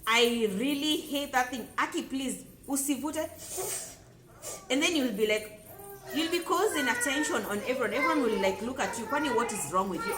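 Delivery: heated rant. Real, not acted. Vlogger ranting at someone slurping tea loudly, irritated. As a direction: vent your irritation at the slurper.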